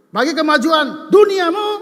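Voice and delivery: loud rising cry, the peak of the sermon; preaching shout. Real, not acted. Pastor urging the congregation, rising loud shout. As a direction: drive the line up to a shout.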